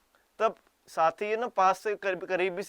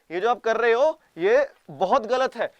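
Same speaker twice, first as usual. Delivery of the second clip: slow, loud, higher put-on scold; mock stern warning; 4 semitones higher, 7 dB louder than usual. Japan's fake warning to Russia, slow loud mock scold. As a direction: drag it out like a pompous official. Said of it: "बोल रहे हो".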